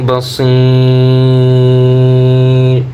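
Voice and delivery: بصير recited very slowly, the ي held long, stopped at the end; three-count stretch. Shown as a demonstration. Teacher showing students the stretch on بصير. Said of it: "টিনের টান হবে".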